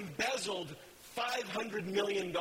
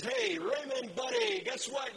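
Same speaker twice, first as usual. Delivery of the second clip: much higher, called-out hey buddy; hammed-up greeting. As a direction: sing out to your neighbor across the yard. Forgiven man greets his neighbor.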